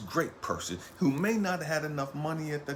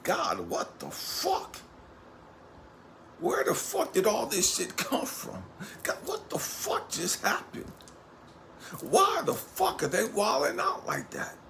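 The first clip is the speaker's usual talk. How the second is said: high, strained anguished pleading to God, partly breathless; broken crying; 9 semitones higher than usual. Retold or imitated. The abandoned partner, left behind and broken, crying out to God. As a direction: cry out to God in disbelief and hurt. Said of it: "frustrated and broken and crying like".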